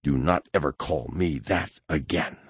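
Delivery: attacker warns the captive, cold and quiet. A narrator's reading. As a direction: warn him quietly. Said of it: "he said".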